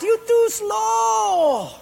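high, slow taunting shout; mocking Portuguese guy. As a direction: jeer it out, high and drawn. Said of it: "that Portuguese guy laughed".

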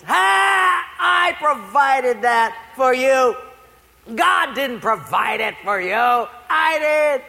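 high, loud gotcha; hammed-up triumphant reveal; gloating shout. Atheist jumping out from behind bushes, gloating shout.

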